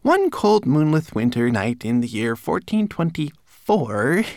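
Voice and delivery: high, slow, declaimed tale; recited. A narrator's reading. Manikin telling his master's legend, reciting high and slow. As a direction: recite grandly in a small servant voice. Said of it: "he began again".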